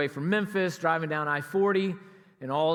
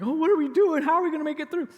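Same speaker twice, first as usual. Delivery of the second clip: high, loud alarmed outcry; rattled driver losing nerve; panicked; 10 semitones higher, 4 dB louder, faster than usual. Retold or imitated. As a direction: cry out as the ice smashes the glass. Ice hitting windshield, panic.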